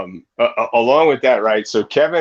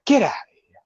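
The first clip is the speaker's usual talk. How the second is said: incredulous whisper; 13 semitones higher, much faster than usual. Retold or imitated. Retold disbelief of Jeremy.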